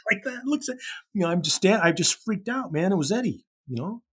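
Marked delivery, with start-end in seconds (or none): none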